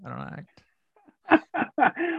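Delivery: drawn out, laughing through it with a high rise; laughing protest. Retold or imitated. Protesting to a frustrated writer through laughter. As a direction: stretch "act" very slowly while laughing. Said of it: "I'm like".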